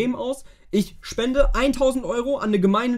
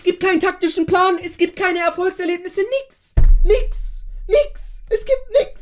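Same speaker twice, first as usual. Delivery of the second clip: high, breaking hopeless wail; mock despair; 8 semitones higher than usual. Real, not acted. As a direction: whine it like you're about to cry. Despair about the team.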